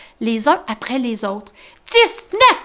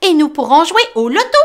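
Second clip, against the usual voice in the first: high, loud glad mole; Tom rejoicing; 6 semitones higher, 7 dB louder, faster than usual. Acted. Tom to Grumbo planning a game, high joyful voice. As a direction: bubble with joy. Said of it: "se réjouit Tom".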